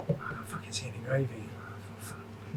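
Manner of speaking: whispered, panicking under his breath; panicked whisper. Retold or imitated. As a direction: hiss it like you're scared to be overheard. Panicked aside about missing gravy.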